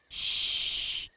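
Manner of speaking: long, voiceless shush; hushing. A narrator's reading. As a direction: hush a friend who interrupts your reading. Sam hushes Buster while reading.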